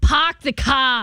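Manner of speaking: Boston New England accent with dropped r's; demonstrating New England speech. Shown as a demonstration. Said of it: "The Boston New England accent".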